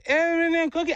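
put-on high-pitched child voice; little boy. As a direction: imitate a small kid asking for a treat. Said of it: "he goes".

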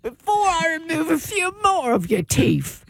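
slow, drawn-out mock-British threat; British parent threatening. Parent orders Nigel to finish rocks, mock British menace. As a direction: warn the child in hammed-up British.

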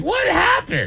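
very high, laughing, baffled mother; bewildered mom. Retold or imitated. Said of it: "And she's like".

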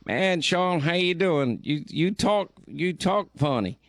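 high-pitched Southern caller impression; imitated Alabama caller. Host retelling callers in Huntsville, imitated high Southern voice. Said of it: "every caller would call in".

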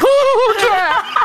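very high, laughing squeal; giddy fangirl gasp. Retold or imitated. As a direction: squeal like a starstruck fan. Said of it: "I was like".

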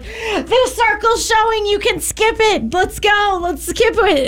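put-on high, slow whiny child pleading; high childish voice urging to skip the ad; excited kid voice. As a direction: imitate the preschooler begging to skip. Kid voiced in parent's story.

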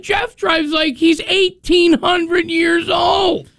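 high, slow mock-outraged shout; a hammed-up indignant comeback; indignant retort.

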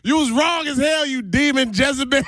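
high shouted laughing taunt. Real, not acted. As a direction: yell it at her through laughter. Gloating after prank revealed.

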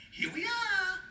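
very high, stretched-out call; lightning reporting in. Acted. Lightnings answering God when sent, high playful call. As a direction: sing it out high, holding the last word.

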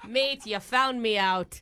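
slow, hammed-up Australian; put-on Australian accent. Prank caller owns up to the job interviewer, in mock Australian. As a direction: play a caught-out Aussie, drawing the words out. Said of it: "did you just change your accent".

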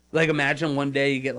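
ordinary delivery

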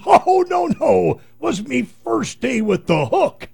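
high loud pirate punchline. Retold or imitated. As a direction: deliver it like a hearty pirate. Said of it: "The pirate says".

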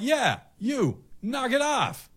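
high, commanding call across the traffic; shouting. Retold or imitated. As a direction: yell it up high to a stranger far off. Shouting at man across street.

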